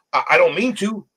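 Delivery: usual speaking voice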